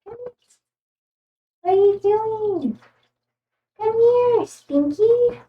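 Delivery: high, sing-song pet-calling voice; cooing to the cat. Real, not acted. Streamer calls her cat Spinky over, high cooing voice.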